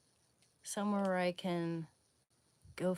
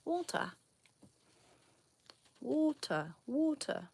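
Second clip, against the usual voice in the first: water as wɔltə, like Walter, higher, falling; British water; 8 semitones higher than usual. Shown as a demonstration. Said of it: "like the way they say the name Walter".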